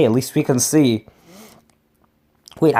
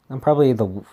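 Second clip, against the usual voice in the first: ordinary delivery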